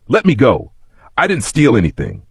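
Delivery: frightened plea, a touch shaky; trembling cry. A narrator's reading. Orphan boy pleading with the bullies, mildly trembling. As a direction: beg to be let go, scared. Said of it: "Kofi cried, his voice trembling".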